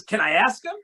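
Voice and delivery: a hint of a high eager cabbie; eager driver. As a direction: ask it like a hopeful kid. Cab driver voiced, eager.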